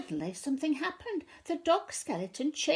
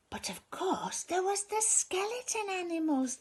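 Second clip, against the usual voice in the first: whisper; 7 semitones higher than usual. A narrator's reading. Dramatic reveal in story.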